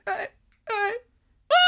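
high stammered squeaks; freaking out. Retold or imitated. Girl in her crush's arms, mock panicked stammer. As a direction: imitate flustered stammering, with gaps between squeaks. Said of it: "she just freaks out".

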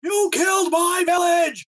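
high, slow shouted battle cry; war cry. Acted. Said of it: "Maybe a shorter war cry".

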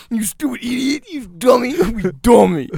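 high-pitched, hammed-up name-calling; mock insulting customer. Acting out the rude customer's insults.

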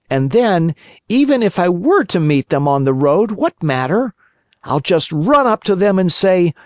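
put-on high boyish puppet voice; cocky little marionette talking to himself; jerky creaky wooden puppet. A narrator's reading. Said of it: "said the Marionette to himself".

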